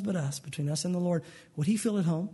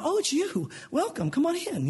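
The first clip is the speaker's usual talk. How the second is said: higher, overly bright greeting; fake welcome; 9 semitones higher than usual. Out-of-breath homeowner welcoming the pastor with fake brightness. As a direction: put on a too-sweet welcome.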